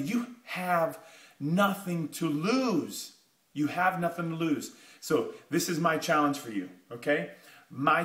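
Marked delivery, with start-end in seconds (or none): 0.1-3.1 slow, drawn-out, each word weighed; slow emphatic. Acted. To listeners with nothing to lose, slow and deliberate. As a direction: lean on it slowly to make it sink in. First slow take of phrase.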